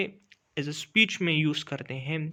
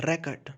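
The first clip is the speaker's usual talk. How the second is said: record said REK-ərd, front syllable stressed; stress on first syllable. Shown as a demonstration. Teacher repeating the noun record, stress on RE. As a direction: stress RE, let the end of record fall. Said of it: "जो आरई है उस पे stress ज़्यादा होता है".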